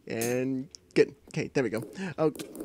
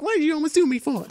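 high, louder, hurried protest; indignant mock protest; 10 semitones higher, 5 dB louder, faster than usual. Voicing the other player's complaint, indignant. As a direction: blurt it out high and fast.

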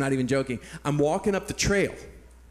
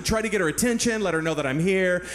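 ordinary delivery

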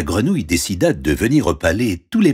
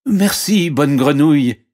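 high, lively thanks; grateful princess; 8 semitones higher, 5 dB louder than usual. Acted. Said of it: "remercia-t-elle". (remerciement aigu de princesse)

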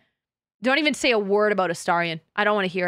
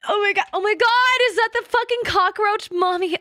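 excited; 8 semitones higher, 4 dB louder than usual. Real, not acted. Excited at game character.

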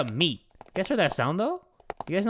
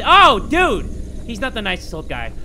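ordinary talk

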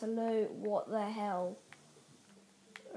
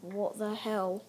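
ordinary delivery

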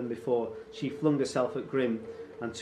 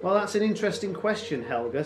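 high, quick, falsely pleasant reply; smiling taunt; 8 semitones higher, 6 dB louder, faster than usual. A narrator's reading. Raider answers frightened mother, mock-pleasant. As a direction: answer her with a smile, toying.

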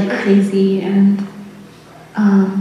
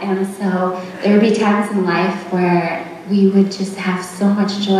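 ordinary talk

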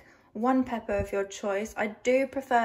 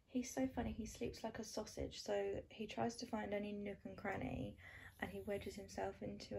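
much softer hushed voice, not to wake the dogs; 12 dB quieter than usual. Real, not acted. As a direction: keep it down beside sleeping pets. Talking beside sleeping dogs.

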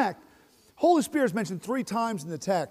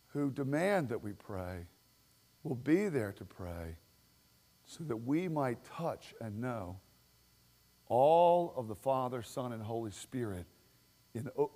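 hushed longing prayer; 8 semitones lower, 10 dB quieter than usual. Real, not acted. Pastor longing for a praying people, hushed. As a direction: breathe it low like a prayer.

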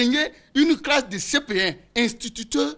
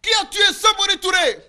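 louder teacher repeat; 8 semitones higher, 4 dB louder than usual. Retold or imitated. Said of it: "Il dit, mais".

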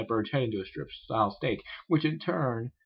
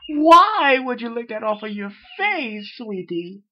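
very high, loud, slow appalled cry; shocked Rarity; 12 semitones higher, 10 dB louder, slower than usual. Acted. Rarity appalled at a friend, crying out very high. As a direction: recoil in horror.

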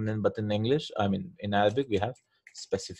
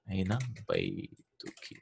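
slow, softer model of Aina baytuki; slow softer repeat; 3 semitones lower, 8 dB quieter, slower than usual. Shown as a demonstration. Teacher repeats for the student: slow, soft model. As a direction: spell Aina baytuki out slowly and gently. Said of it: "teacher repeats slowly".

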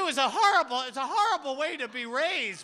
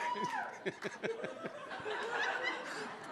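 high breathy giggle; falsetto giggle; 11 dB quieter than usual. Acted. Comic to the crowd, high giggle.

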